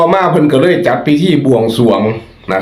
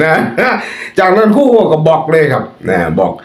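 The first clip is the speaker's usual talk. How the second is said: usual speaking voice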